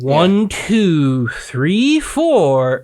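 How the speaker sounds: over-enunciate each number condescendingly; mock idiot slowness. Shown as a demonstration. Reading the address to a post office lady, mock condescending. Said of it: "don't wanna sound like an idiot".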